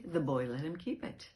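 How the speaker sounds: ordinary delivery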